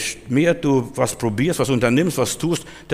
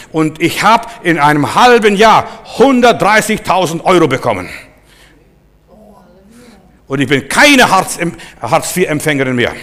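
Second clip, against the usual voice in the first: loud, higher, punched key words; forceful preaching; 5 semitones higher, 7 dB louder than usual. Real, not acted. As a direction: proclaim it to the congregation. (mit Nachdruck gepredigt)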